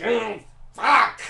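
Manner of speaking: a despairing loud swear; frustrated curse. Retold or imitated. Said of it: "And Beethoven's like".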